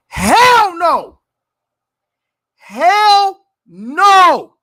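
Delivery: HELL NO shouted loud and hard, both words hammered; emphatic shout. Real, not acted. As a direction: slam every word of "Hell no" like a final verdict. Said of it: "I'm going to put the emphasis on this".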